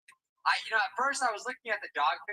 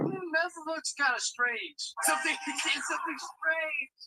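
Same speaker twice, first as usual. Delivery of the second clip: dim-witted inner voice; 6 semitones higher, 4 dB louder than usual. Retold or imitated. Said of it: "she never thought, like".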